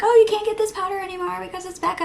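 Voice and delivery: high, fast gossipy chatter; mock gossip voice. Friends telling her the news in a fast gossipy voice. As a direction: rattle it off like excited friends. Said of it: "Everybody's like".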